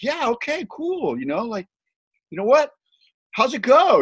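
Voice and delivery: high, strained polite yeah; polite humoring. Retold or imitated. Evan humoring Dale out of respect, high forced politeness. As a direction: humor him with forced cheer.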